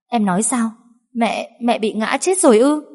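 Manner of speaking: high, shocked questioning; disbelieving. A narrator's reading. Daughter-in-law hearing of the fall, disbelieving. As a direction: stammer it out in disbelief. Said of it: "Cô như không tin nổi vào tai".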